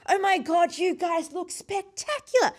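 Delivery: high, gushing mock British lady; terrible British accent. British lady admiring the bridal party, hammed-up British accent. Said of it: "terrible British accent, by the way".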